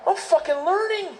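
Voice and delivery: high, thrilled exclamation; overjoyed discovery; excited. Retold or imitated. Thrilled at understanding Russian.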